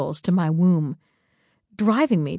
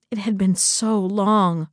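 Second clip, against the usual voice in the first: usual speaking voice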